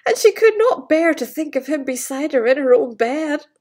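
plain reading voice

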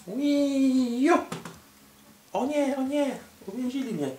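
high panicked squeal; squeaky high trapped voice. Pretend. Villain caught in trap.

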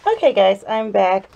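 talking through a mask; muffled behind a face mask. Real, not acted. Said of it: "if I sound a little muffled".

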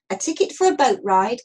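plain reading voice

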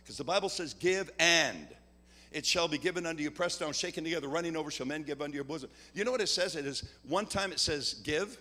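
preacher urging the congregation loudly to sow money; full-force preaching. Acted. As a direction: preach it to the crowd, driving hard. Preaches the offering appeal at full force.

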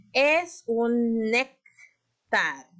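Es un néctar drawn out slowly; stretched emphasis. Shown as a demonstration. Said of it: "levanten la mano si escuchan".

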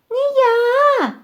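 tiny squealed refusal; squeaking mouse. A narrator's reading. Mouse Krut refuses to knead dough, squeaking. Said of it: "пищить круть".

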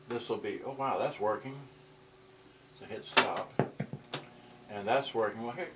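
ordinary talk, nothing marked

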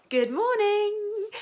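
high, slow, drawn-out good morning; high drawn-out presenter greeting; sing-song greeting. Shown as a demonstration. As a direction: stretch it out like a cheery host. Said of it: "wanting to say it again".

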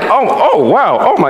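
high, broken little gasps of dismay; mock shock. Believer rewatching an old movie, hammed-up scandalised shock. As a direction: react as if scandalised by the screen.

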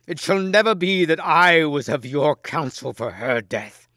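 high, loud declaration; cried protest. A narrator's reading. Gawain refusing to counsel the queen's death, crying out. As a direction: refuse forcefully, starting at full voice. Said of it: "cried Gawain".

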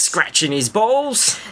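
lightly imitated narrator, high and slow; Brian Cox. Finishing the comic rewrite of the novel, high, slow mock narration. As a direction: draw it out high in a hint of a presenter's voice. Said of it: "Is that Brian Cox?".

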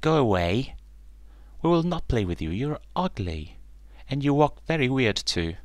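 storybook yelling, lightly acted; mild yell. A narrator's reading. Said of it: "And they yelled".

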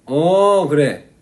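loud, breezy buddy hello; casual friend greeting. Shown as a demonstration. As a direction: greet him like an old pal. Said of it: "약간 이런 식으로".

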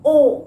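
a long loud ओ; long oa sound. Shown as a demonstration. Teacher stressing that ओ is long. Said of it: "थोड़ा ये long sound है".

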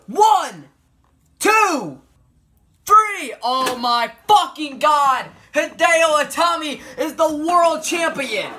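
loud, high play-by-play yelling; excited shouting. Real, not acted. Gamer screaming at the screen as the champion wins, excited shout. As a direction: scream the count and the winner like a hyped commentator. Playing a wrestling game, elimination celebrated.

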